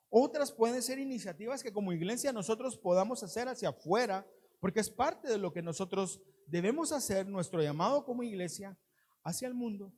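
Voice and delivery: ordinary talk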